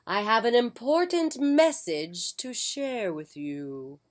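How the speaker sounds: put-on very slow, grand old wizard voice; a ponderous ancient wizard taking his time. Wizard greeting the mother at the door, slow and grand.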